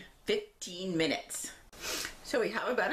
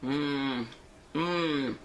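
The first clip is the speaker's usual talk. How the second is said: savoring mmm; 3 semitones lower than usual. Real, not acted. Tasting hot cookie.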